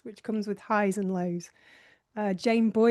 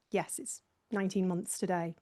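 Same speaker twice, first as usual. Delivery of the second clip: usual speaking voice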